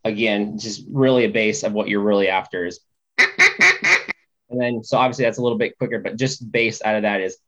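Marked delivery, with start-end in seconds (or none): 3.2-4.8 duck call honks. Shown as a demonstration.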